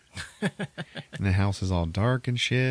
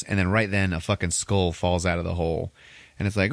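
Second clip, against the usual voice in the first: ordinary talk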